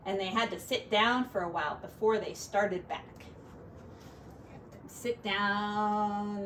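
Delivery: gentle storybook narration; reading to children. Acted. Parent reading a picture book to a child, storybook voice. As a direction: read it aloud to a little one.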